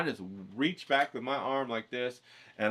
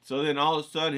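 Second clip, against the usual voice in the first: usual speaking voice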